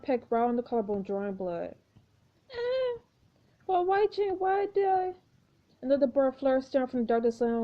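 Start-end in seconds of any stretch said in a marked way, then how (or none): 2.5-5.1 high confused voice. Acted. Lily hurt, confused.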